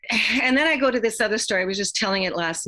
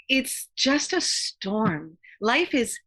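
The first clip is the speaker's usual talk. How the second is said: slow and deliberate; much slower than usual. Real, not acted. Closing point, slowed.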